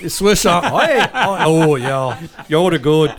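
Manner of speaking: laughing through a high mock replay. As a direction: crack up while imitating the dad. Laughing replay of dad's answer.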